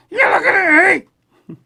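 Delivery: squeaky puppet tough guy. Acted. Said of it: "He's trying to say".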